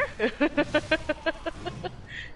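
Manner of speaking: high wild cackle. Real, not acted. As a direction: cackle like a cartoon villain. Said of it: "Did you hear that cackle?".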